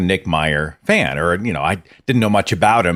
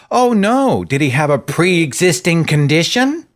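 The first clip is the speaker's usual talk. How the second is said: mock reaction; 8 semitones higher than usual. Voicing dismissive listener.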